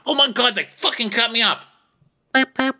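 high, mock-outraged squeal; imitating Ben angry in traffic; mock angry driver. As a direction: squeak out a timid road-rage rant. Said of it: "what Ben sounds like angry in traffic".

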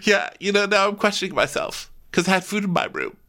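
hammed-up self-pitying whine; whiny Vicky voice. Impersonating Vicky, weepy.

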